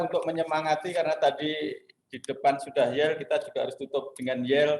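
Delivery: ordinary talk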